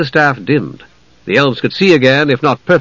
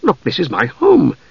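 higher, stammering confusion; bewildered; 3 semitones higher than usual. A narrator's reading. Young mage answers the knight, bewildered and halting. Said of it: "Palin was bewildered".

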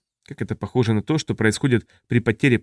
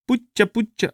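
high little alien babble; babbling; 10 semitones higher, slower than usual. A narrator's reading. Taprisiot babbles when questioned, high-pitched jabber. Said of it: "залопотал Тапризиот".

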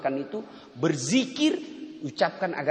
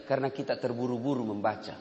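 usual speaking voice

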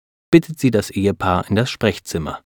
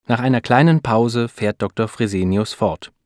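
ordinary delivery, nothing marked

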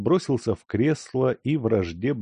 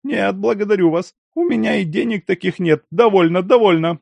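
high, loud frantic refusal; 12 semitones higher, 7 dB louder than usual. A narrator's reading. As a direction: shout it off in panic. Lyakhovsky refuses loudly.

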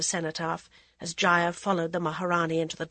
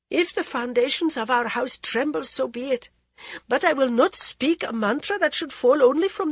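high, loud proud refusal; defiant queen; 6 semitones higher, 5 dB louder than usual. Acted. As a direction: refuse with royal pride.

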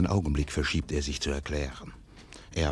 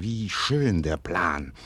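ordinary talk

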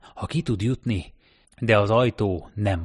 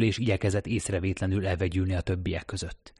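ordinary delivery

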